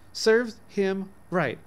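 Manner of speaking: put-on a little girl's firm verdict; emphatic. A narrator's reading. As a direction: stamp out every word like a final ruling. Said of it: "said Mabel emphatically".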